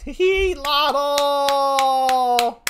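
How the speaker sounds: high, drawn-out hail held on one note; gleeful greeting. Real, not acted. Streamer calling out to a guest in chat, gleeful. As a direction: giggle into a high, long-held name.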